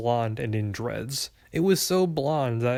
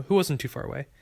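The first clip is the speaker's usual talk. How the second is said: ordinary delivery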